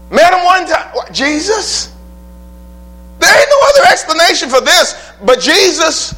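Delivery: high, loud exclaiming with a laugh; full-force preaching. Acted. Preacher marvelling at a stranger's hospitality, loud high preaching.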